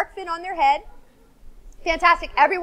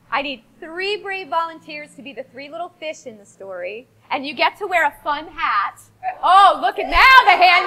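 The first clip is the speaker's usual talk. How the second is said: usual speaking voice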